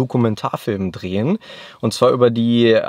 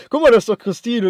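mock pointing out; 8 semitones higher, 6 dB louder than usual. Retold joke said to cousin.

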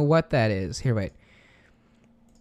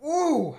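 pained high gasp; 12 semitones higher than usual. Real, not acted. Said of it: "I am tearing up".

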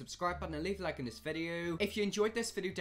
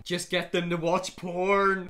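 slow, loud, hammed-up delivery; milk the outrageous line; loud mock-innocent provocation; cheeky troll; 2 semitones higher, 8 dB louder, slower than usual. Reads her troll comment then cringes.

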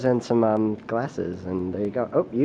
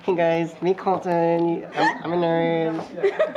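nasal put-on YouTuber voice, slow; mock his made-up video voice; Colton's YouTube voice; 5 semitones higher, much slower than usual. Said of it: "you got my voice down".

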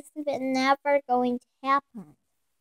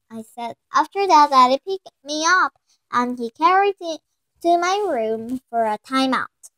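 plain reading voice

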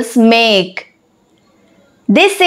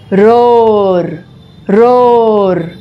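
low roar; 5 semitones lower than usual. Shown as a demonstration. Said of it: "What sound does a lion make?".

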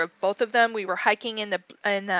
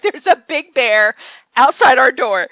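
loud insistence; 2 semitones higher, 12 dB louder than usual. Retold or imitated. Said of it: "He's like".

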